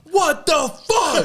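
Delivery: high, slow, laughing mock yell; a laughing high-pitched imitation of an angry outburst; mock outraged yell. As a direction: act out the furious passenger.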